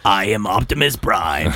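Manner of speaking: deep, slow robot leader voice; Optimus Prime. Acted. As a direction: announce it low and slow like a giant robot. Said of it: "How about".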